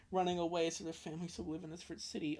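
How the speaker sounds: ordinary delivery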